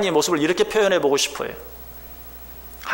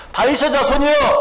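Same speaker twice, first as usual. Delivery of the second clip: high pleading call to Jesus; crying out; 8 semitones higher, 3 dB louder than usual. Acted. Raised, pleading cry. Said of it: "주님 앞에 외치죠".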